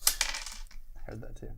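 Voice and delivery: soft unvoiced whisper; whispered aside. Real, not acted. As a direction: whisper it under your breath. Quiet aside.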